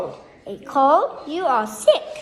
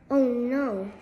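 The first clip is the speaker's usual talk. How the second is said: ordinary delivery, nothing marked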